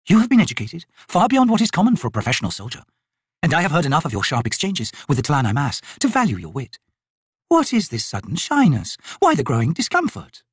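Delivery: high, insistent noblewoman; haughty lady, probing. Acted. Lady challenging the soldier's modesty, probing. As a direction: press him with cultured, pointed questions.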